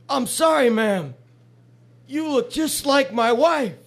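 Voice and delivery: put-on high-pitched, slow drunk apology; joke's drunk man. Drunk husband explains himself to the woman who slapped him, high drunk voice.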